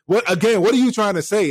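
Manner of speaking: pressing the doubting woman again, loud and affronted; offended challenge. Retold or imitated. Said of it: "I was like".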